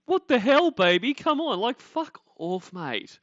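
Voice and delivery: high, loud mocking whine; mocking her partner's fake-cutesy tone; mocking boyfriend. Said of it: "he thinks this is cutesy".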